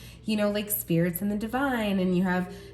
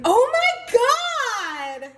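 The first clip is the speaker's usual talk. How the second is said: a much higher, louder, slow cry of joy; excited shout; 7 dB louder, much slower than usual. Real, not acted. Reader overjoyed at a new card, loud excited cry. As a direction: burst out in real excitement at the card. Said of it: "I got really excited. Sorry".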